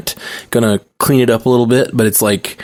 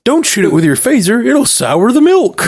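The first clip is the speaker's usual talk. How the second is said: alien farmer; 12 semitones higher than usual. Retold or imitated. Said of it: "an alien runs up".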